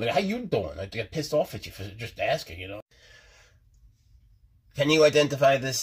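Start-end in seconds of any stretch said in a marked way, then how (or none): 0.1-0.8 high-pitched mock New York comeback; New York greeting. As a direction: throw it back in a New York accent. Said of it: "It's like in New York".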